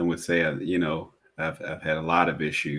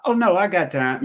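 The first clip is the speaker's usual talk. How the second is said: high, bright, louder breezy brush-off; mock carefree yes; breezy reassurance; 12 semitones higher, 5 dB louder than usual. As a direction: toss it off like it's no problem. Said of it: "I'll just be like".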